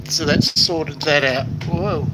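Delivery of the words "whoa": usual speaking voice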